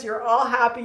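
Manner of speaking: ordinary delivery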